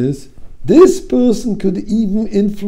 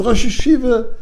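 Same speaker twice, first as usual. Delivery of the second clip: desperate plea; 8 semitones higher, 4 dB louder than usual. Retold or imitated. Student pleads with Rosh Yeshiva.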